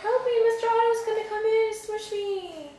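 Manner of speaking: high, loud mock plea; a scared swimmer squealing to flee the fish; hammed-up frightened cry; panicked kid. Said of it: "Look at him".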